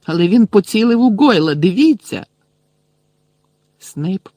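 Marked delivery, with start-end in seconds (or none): 0.1-2.2 high, loud urgent plea; urgent protest. A narrator's reading. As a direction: point it out urgently. Harry pleading his case loudly.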